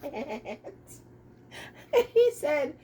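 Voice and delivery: usual speaking voice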